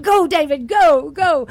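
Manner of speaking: high, loud cheer from the sidelines; root for David like a fan in the stands. Retold or imitated. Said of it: "I'm like".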